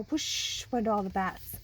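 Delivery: ordinary delivery, nothing marked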